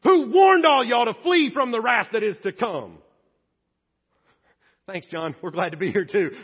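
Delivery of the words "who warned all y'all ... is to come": booming fire-and-brimstone denunciation; fiery prophet shout. Acted. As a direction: blast them like an angry prophet. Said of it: "And John looks up and goes".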